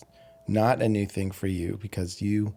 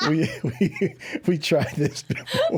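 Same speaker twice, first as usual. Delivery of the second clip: giggly and high; laughing while talking; 6 semitones higher, slower than usual. Real, not acted. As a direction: talk through the giggles. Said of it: "She's got the giggles".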